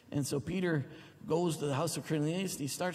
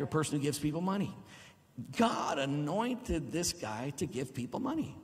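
ordinary talk, nothing marked

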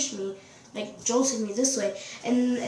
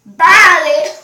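loud, raised-pitch shout; shouted dismissal; 5 semitones higher, 16 dB louder than usual. Real, not acted. She sends off someone interrupting, shouted dismissal. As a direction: yell it to end the conversation. Calling out a dismissal mid-recording.

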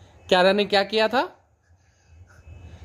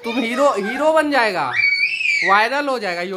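teasing coax; 5 semitones higher than usual. Real, not acted. Teasing shy husband to show face.

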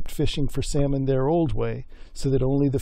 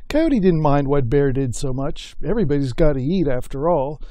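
usual speaking voice

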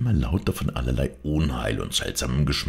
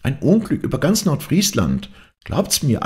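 high, insistent maid's voice; agitated gossip; 11 semitones higher, 3 dB louder than usual. A narrator's reading. Maid warns the others of disaster, high agitated voice. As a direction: insist on the dire rumour, pitched high. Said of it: "rief Angrete".

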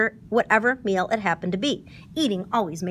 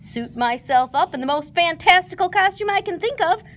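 excited Tigger; 8 semitones higher, 5 dB louder than usual. A narrator's reading.

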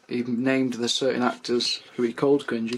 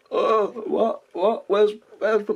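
put-on high, dazed drunk voice; drunk confusion; 9 semitones higher, 4 dB louder, slower than usual. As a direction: slur it high and lost. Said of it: "I'm just there going".

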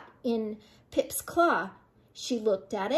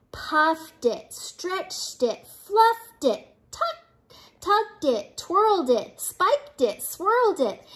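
high, punchy list reading; playful frenzy; 6 semitones higher, slower than usual. A narrator's reading. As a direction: make each action pop upward. List of fuzz play, read dramatically.

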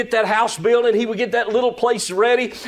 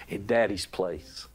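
put-on soft, slow and much lower, like a child saying it; tender and hushed; 12 semitones lower, 10 dB quieter than usual. Shown as a demonstration. Preacher rephrasing the verse for his congregation, tender and hushed. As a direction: say it gently, slowly, almost under your breath. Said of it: "I love how he said it".

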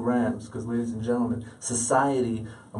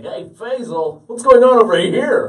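high, booming mock-friendly holler; loud buddy callout; 10 semitones higher, 9 dB louder than usual. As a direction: call out loudly, imitating a heckling buddy. Loudly imitates his buddy Timmy.

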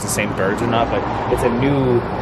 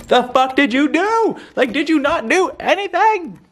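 much higher, slower, outraged disbelief; incredulous outburst; 3 dB louder than usual. Retold or imitated. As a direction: screech it up high like you can't believe it. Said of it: "And I'm like".

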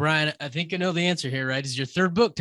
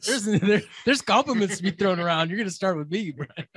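higher, laughing mock-vain colleague; a hint of a jealous partner demanding the compliment first; imitating Anthony; 6 semitones higher than usual. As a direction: tease him by playing his wounded pride. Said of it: "And Anthony's like".

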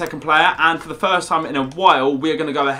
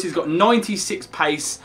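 ordinary talk, nothing marked

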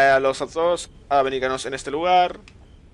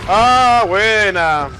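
excited shout; 5 semitones higher, 6 dB louder, much slower than usual. Real, not acted. Excited at good shot.

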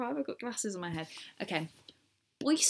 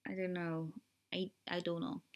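softer, half-hearted American attempt; failed American accent; 8 dB quieter than usual. Shown as a demonstration. British vlogger testing herself, failed American accent. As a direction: try a tentative American accent, unconvincingly. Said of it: "that definitely wasn't American".

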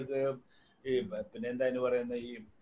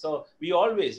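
usual speaking voice

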